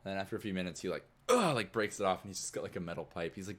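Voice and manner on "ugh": loud, high straining grunt; acted heave of effort. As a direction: grunt as if wrenching something loose. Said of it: "trying to, like, break it off".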